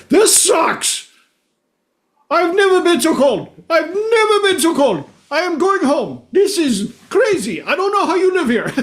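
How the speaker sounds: very high, exasperated mock South African voice; hammed-up South African outrage; South African accent. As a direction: imitate a freezing South African protesting in a high pitch. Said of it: "I'm from South Africa".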